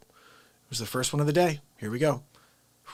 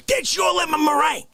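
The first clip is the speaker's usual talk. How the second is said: shout; 11 semitones higher, 4 dB louder than usual. Acted. Bakery story climax.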